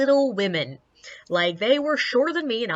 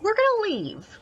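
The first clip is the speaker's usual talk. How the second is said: urgent; 12 semitones higher than usual. Retold or imitated. And I'm like.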